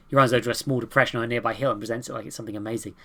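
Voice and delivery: ordinary talk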